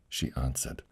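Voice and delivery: ordinary delivery, nothing marked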